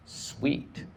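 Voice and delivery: breathy, voiceless approval; whispered sweet. Retold or imitated. Pleased whisper on hearing about the weed. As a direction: hush it out, pleased. Reacting to yacht plan.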